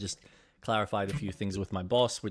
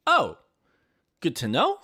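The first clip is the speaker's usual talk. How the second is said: mock surprised sarcasm; 7 semitones higher, 5 dB louder than usual. Reacting to surprise closing shift.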